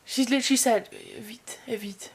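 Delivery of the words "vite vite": breathy whispered demon voice; demonic whisper. Retold or imitated. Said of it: "whispering in the same demonic voice".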